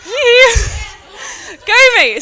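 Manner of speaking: loud, high little cheer for herself; triumphant self-congratulating shout; mock self-cheer. Real, not acted. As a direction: cheer yourself on with a laugh. Mock self-cheer after confession.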